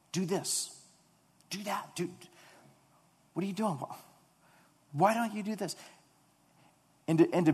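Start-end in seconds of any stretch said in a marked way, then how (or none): 0.1-5.8 mock bossy demands. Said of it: "we try to counsel God".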